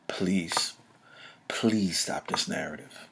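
low, soft and weary-sounding plea; quiet, slow, low-pitched appeal; hushed plea. Real, not acted. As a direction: drop right down and beg quietly.

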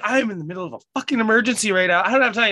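high, fast frantic brush-off; retold panic. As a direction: act out being urgently harried. Retelling panicked brush-off of friend.

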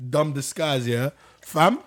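ordinary talk, nothing marked

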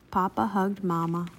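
ordinary delivery, nothing marked

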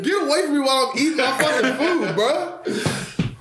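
high, laughing get away from me; laughing rant. Real, not acted. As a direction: laugh while telling him off. Laughing outburst at the boss.

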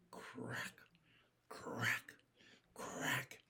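breathy whispered crack sounds; hushed twig-snapping whisper. A narrator's reading. Noise heard in the woods.

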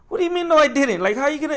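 high, loud, indignant disbelief; pissed off. Retold or imitated. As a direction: retell it as an outraged protest. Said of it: "but I was pissed".

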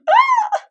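sudden high yelp of shock; a squeal of surprise. Retold or imitated. Reacting to the visit with a high yelp.

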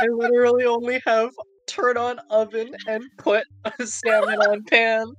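high, loud protest through laughter; laughing exasperation. Real, not acted. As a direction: protest while cracking up at yourself. Cracks up over lack of progress.

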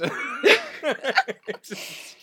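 bursting out laughing. Real, not acted. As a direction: laugh out loud.

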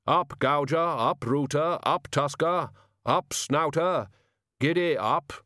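drawn-out commands to harnessed animals; calling the team. A narrator's reading. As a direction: urge each beast up in turn. Figure calling to the boar team.

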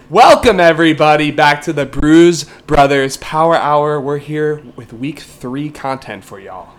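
big, loud opening that settles as it goes; booming welcome, then easing down; podcast host intro. Acted.

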